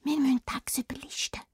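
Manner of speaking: fast, soft urgent whisper. Acted. Anna whispering the plan to the frog king.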